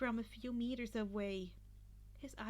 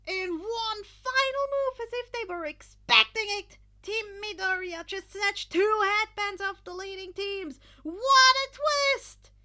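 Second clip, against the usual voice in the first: loud announcer; 9 semitones higher, 13 dB louder than usual. Acted. Present Mike hyping the crowd, booming announcer. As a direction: call it like a stadium announcer. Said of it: "Present Mike cheered".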